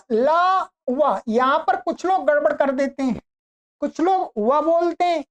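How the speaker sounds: ordinary talk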